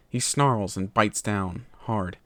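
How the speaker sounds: plain reading voice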